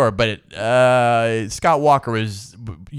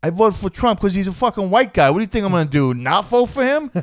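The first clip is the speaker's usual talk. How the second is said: higher, brash Jersey tough guy; mock Trump voter; 7 semitones higher than usual. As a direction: bluster it like a proud blunt voter. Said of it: "They just go".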